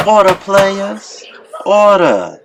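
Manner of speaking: high, slow commanding call to order. A narrator's reading. Chairman calling the pimp coalition to order, commanding. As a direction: bang the gavel with your voice. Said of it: "Someone slammed a cane on the table".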